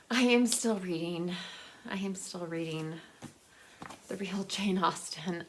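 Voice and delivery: ordinary delivery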